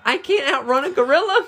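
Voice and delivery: high, loud panicked protest; panicked yell. Real, not acted. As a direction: yell it while being chased. Chased in game.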